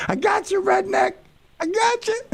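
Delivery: squeaky high mock voice; high playful taunt at the rival host; teasing falsetto. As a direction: tease him in a silly high voice.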